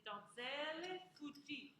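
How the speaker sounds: slow "donzelle fujite" stretching "ZEL" and "JEE"; middle syllables stressed. Shown as a demonstration. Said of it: "middle syllable is the one".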